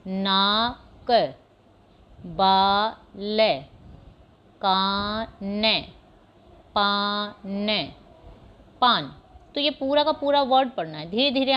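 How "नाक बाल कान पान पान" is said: very slow, each word drawn out; slow model reading. Shown as a demonstration. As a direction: read each word slowly for pupils to copy. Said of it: "जैसे मैं पढ़ रही हूँ". (बच्चों के लिए धीरे-धीरे पढ़े गए शब्द)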